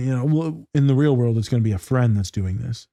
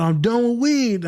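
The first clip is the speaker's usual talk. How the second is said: much higher, slow client voice; 10 semitones higher than usual. Retold or imitated. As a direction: play the proud client, pitched up. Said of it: "client the other day said".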